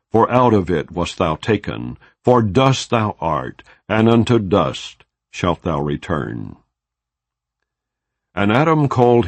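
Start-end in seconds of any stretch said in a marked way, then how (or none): none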